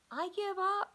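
high, loud frustrated shout. Acted. Emma giving up on finding Lamb, loud high shout. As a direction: throw your hands up and shout it. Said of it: "she shouted".

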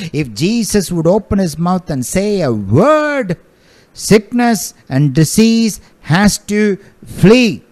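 preaching at full force. Acted. Preacher exhorting congregation about authority, fervent. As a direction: press the point home to the church.

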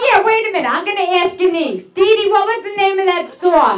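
high, lively mother voice; imitated mom, high and bright; mom asking the niece. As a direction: call across the room high. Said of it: "she's like".

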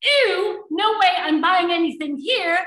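rehearsed yell of revulsion; mock yell. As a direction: act out a disgusted customer's outcry. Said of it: "And you yell".

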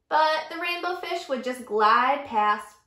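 usual speaking voice

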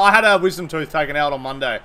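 ordinary talk

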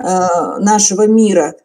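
ordinary talk, nothing marked

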